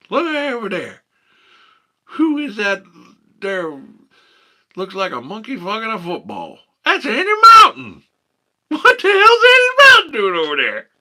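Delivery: very high, loud, hammed-up Appalachian hollering; hillbilly voice. Streamer clowning as a West Virginia local, high and shouting. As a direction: squeal it like a yokel spotting a monkey.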